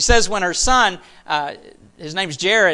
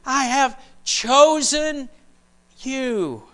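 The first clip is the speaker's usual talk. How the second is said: reassuring God voice; 5 semitones higher, slower than usual. Acted. Preacher voicing God's reassurance.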